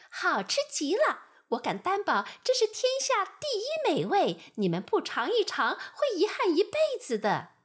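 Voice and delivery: high, loud fly voice raving; boasting fly. Acted. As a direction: sell them on the honey. (夸张的苍蝇)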